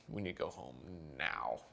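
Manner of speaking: soft and low, slowing into "now"; retold quiet plea, pitch sinking; low urgent undertone. Husband telling wife they must get home, low urgent undertone.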